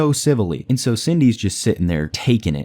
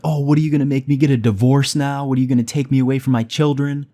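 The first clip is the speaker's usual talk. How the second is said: mildly raised, higher mock yell; half-voiced argument, pitched up; retold yelling back; 5 semitones higher, faster than usual. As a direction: imitate an indignant wife firing back. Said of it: "Cindy's yelling back at her".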